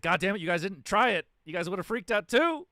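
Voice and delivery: loud, high-pitched mock outburst; exasperated yell. Retelling his comeback to the doubters, exasperated yelling. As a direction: yell it in indignant self-defence, acted out. Acts out protesting to the group.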